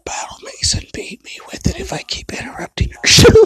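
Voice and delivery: hushed whisper aside. Real, not acted. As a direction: whisper it so she won't hear. Aside while girlfriend is nearby.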